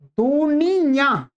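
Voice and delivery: "दुनिया" drawn out with a nasalised final yaan; nasal ending. Shown as a demonstration. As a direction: slowly let the last vowel of "दुनिया" go through the nose. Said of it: "नासिका से भी बोल रहे हैं".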